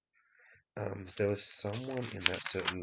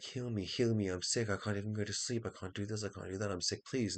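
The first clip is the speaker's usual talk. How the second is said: higher, urgent imitation of begging; mock pleading; 4 semitones higher, 4 dB louder than usual. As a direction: play a desperate sick person begging. Acting out someone begging for healing.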